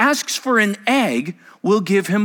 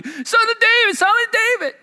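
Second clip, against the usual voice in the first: much higher, pleading woman's voice; imitating the begging woman. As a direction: whine it like the woman crying after them. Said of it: "hear her going".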